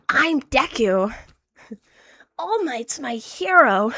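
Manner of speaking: slow, lightly hammed-up dub hero; drawn-out cringey dub delivery; mild overacted anime boy; cringe anime dub as Deku. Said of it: "a cringe anime dub".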